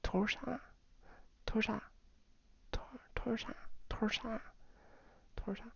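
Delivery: soft whispered 土豆沙; whispered drill. Shown as a demonstration. Teacher whispers 土豆沙 as practice. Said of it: "Um, 土豆沙".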